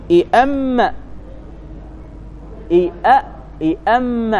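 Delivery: إئم said with a hamza and a stressed doubled م; إئم drawn out slowly as a demonstration; pronouncing the merged form. Shown as a demonstration. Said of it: "ضغمنا الميم في الميم وفتحنا الهمزة". (نطق إئم بهمزة مكسورة وميم مشددة)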